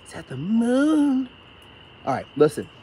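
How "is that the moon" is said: high, slow wondering; amazed. Real, not acted. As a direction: gasp at the moon. Sudden wonder at the sky.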